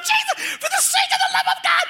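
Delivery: very high, shrieking mock scream; panicked yell. Passengers yelling at oncoming cars, high shrieking scream. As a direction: scream it like the car is about to crash.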